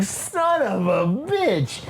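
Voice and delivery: high, long-held hammed-up wail of a curse; pained cursing. Retelling the broken hand, exaggerated pained cursing. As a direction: draw each word out in mock agony.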